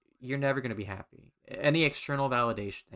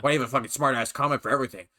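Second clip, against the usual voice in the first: mock annoyed gamer, louder and rushed; irritated retort; 5 dB louder, much faster than usual. Gamer snapping back at a joke about healing, irritated. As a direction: imitate a fast, loud, irritated comeback.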